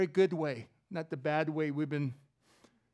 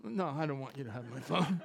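laughing; 6 semitones lower, 4 dB quieter than usual. Real, not acted. Joking refusal to share phone.

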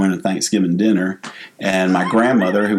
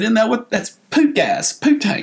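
high, slower innocent explaining; imitated confused kid. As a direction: explain it earnestly as a puzzled child, high up. Voices his confused child self.

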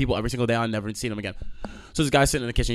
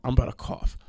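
ordinary delivery

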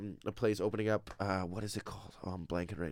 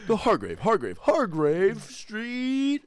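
announcer; 12 semitones higher, 7 dB louder, much slower than usual. Pretend. Announcing the market like radio hosts.

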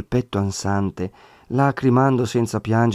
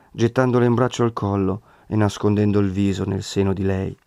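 plain reading voice

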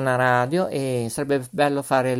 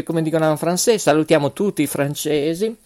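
usual speaking voice

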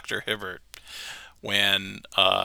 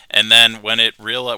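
ordinary talk, nothing marked

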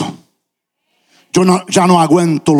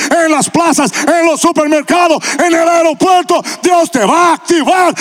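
high, loud, building preacher's shout, slowing at the end; full-force preaching; 9 semitones higher, 3 dB louder than usual. Acted. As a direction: rouse the congregation to their feet.

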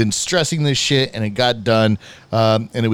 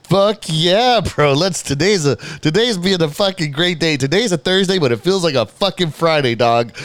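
hyped; 5 semitones higher than usual. Retold or imitated. Retelling own joy at cheap vape.

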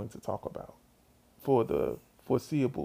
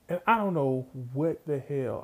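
plain reading voice

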